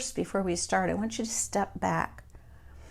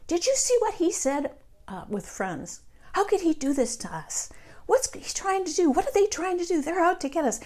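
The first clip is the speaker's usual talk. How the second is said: much higher, agitated complaining to friends; mock outraged gossip; 11 semitones higher, 3 dB louder than usual. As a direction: ham up the alarmed outrage, stirring people up. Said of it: "I have other people upset too".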